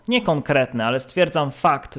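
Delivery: ordinary talk, nothing marked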